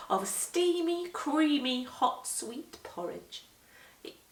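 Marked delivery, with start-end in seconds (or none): none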